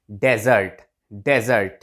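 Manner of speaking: डेजर्ट as "DE-zert", falling; desert pronunciation. Shown as a demonstration. Teacher repeating the English word for learners, "DE-zert" pronunciation. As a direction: voice the middle Z in डेजर्ट. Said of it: "simple pronunciation है डेजर्ट".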